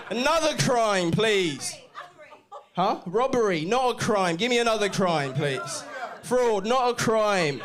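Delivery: high, loud, punchy crowd work; loud calling out. Real, not acted. Comedian dismissing crimes the audience shouts, calling out loudly. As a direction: fire it out loudly over the room.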